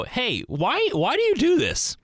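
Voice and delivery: pent-up frustration bursting out high; sudden exasperated outburst. Retold or imitated. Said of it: "all of a sudden you go".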